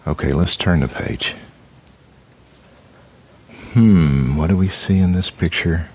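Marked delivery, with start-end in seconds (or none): none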